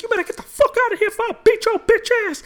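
high, loud mock threat from a street fighter; threatening old man.

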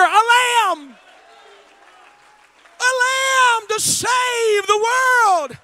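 loud, driving preacher's cry, stretched key words; full-force preaching. Acted. Preacher pleading with the congregation, full-force preaching. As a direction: preach it out to the whole church.

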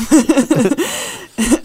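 high, loud burst of laughter; real laugh. Real, not acted. Host cracks up at own troll joke, laughing. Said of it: "I'm trying not to laugh".

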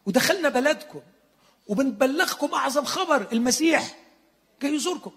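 self-important apostles ranting; indignant disciples. Acted. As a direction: play the offended disciples, hammed up. Indignant disciples voiced, mocking.

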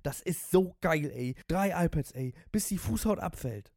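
high, loud raving sports freak; ecstatic. A narrator's reading. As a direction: punch out each favourite in ecstasy. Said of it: "in seiner Ekstase".

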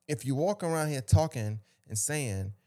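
ordinary delivery

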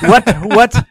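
high, loud laughing "what"; laughing disbelief. Real, not acted. Host reacting to the towel story, laughing in disbelief.

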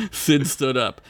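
ordinary talk, nothing marked

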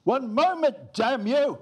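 high, loud, angry outburst; acted shout. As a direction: bellow it at someone counting you in. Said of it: "and he's shouting".

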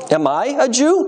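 high-pitched, scornful comeback question; retort. A narrator's reading. Pilate answers his prisoner's question, retort. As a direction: throw the question back at him. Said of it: "Pilate retorted".

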